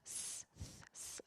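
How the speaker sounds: voiceless "s" blown softly; hissed s. Shown as a demonstration. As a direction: hiss an s between the teeth. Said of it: "fazendo também o s". ("s" soprado)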